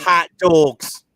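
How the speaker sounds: put-on Chicago accent. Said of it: "your Chicago accent".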